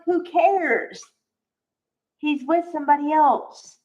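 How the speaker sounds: indignant mock reply; dismissive retort. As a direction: brush the question off with disdain. Scornful retort to the character.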